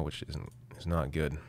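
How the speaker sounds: hoarse, worn-out voice. Real, not acted. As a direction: voice giving out, hoarse and tired. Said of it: "I'm losing my voice right now".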